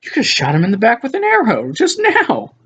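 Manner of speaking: rising incredulous cry at the screen; incredulous outburst. Retold or imitated. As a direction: voice jumps high in disbelief. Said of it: "And I'm like".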